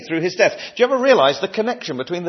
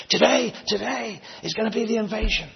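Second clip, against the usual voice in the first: higher-pitched voice of a film character getting the message; French resistance member; 6 semitones higher than usual. Acted. French resistance realising the invasion is today, acted higher-pitched. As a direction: voice the awaited news, pitched up. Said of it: "French resistance are waiting for their message".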